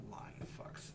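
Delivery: whispered swearing; very soft, breath-only grumble; muttered curse. Acted. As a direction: curse them under your breath. Curse after finding no key.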